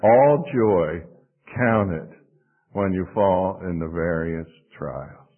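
measured unison-style reading; recited together. Shown as a demonstration. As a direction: lead the room phrase by phrase, dropping low at the end. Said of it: "Let's say that together".

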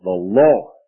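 "Lord" held long and weighted, pitch low; stress on Lord. Shown as a demonstration. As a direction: lean on "Lord" slowly. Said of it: "put the emphasis on each word".

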